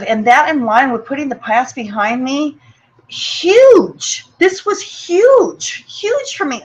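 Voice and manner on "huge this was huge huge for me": fighting back tears, the voice jumps up and breaks; holding back tears. Real, not acted. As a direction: voice cracking high on the word huge. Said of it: "trying to hold back the tears right now".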